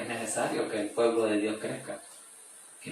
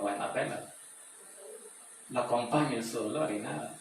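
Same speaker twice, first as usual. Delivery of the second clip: ordinary talk, nothing marked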